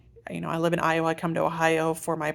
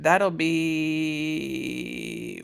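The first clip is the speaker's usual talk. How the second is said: drawn out while counting. Real, not acted. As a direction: stretch BE out very long. Stalling while counting up weeks.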